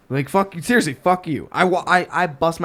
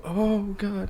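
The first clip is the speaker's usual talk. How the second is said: hushed, higher fearful reaction; imitated fear; 5 semitones higher, 7 dB quieter than usual. Passers-by react to the man leaving his car, mock fearful. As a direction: say it softly, as if mildly alarmed. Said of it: "out of fear".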